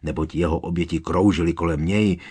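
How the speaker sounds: ordinary delivery, nothing marked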